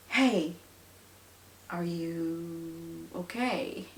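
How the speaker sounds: soft, very slow check-in; calm. Shown as a demonstration. Parent down at a tantruming child's level, calm and soft. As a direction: gently draw out the question, unhurried. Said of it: "So you calmly get down to their level and say".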